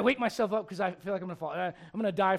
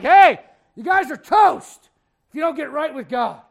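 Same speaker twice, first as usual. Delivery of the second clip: loud, slow, higher call to the city; mock prophet's warning; preaching warning; 4 semitones higher, 15 dB louder than usual. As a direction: warn the whole city at the top of your voice.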